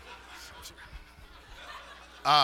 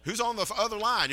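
Green Acres character; 6 semitones higher than usual. Retold or imitated. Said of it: "like on Green Acres".

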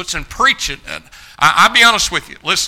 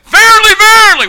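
much higher, loud verily verily; shouted verily; 14 dB louder than usual. Retold or imitated. Preacher quotes Jesus to young men, shouting. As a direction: trumpet it out high. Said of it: "but he said".

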